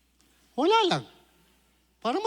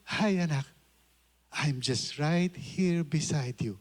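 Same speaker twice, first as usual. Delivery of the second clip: soft, slow, tender reassurance; gentle voice of God; 4 semitones lower, 3 dB quieter, slower than usual. Retold or imitated. The Lord answering a worried child, softly and slowly. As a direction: speak like a loving father close by. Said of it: "And the Lord is saying".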